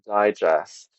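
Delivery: "digest" as DIE-jest; first-syllable stress. Shown as a demonstration. Host showing the English noun, stress on first syllable. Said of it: "stressed on the first syllable".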